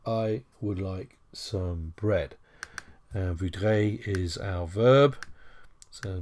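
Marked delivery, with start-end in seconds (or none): 0.1-2.3 slow translation. Shown as a demonstration. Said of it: "je voudrais du pain".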